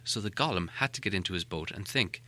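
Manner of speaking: plain reading voice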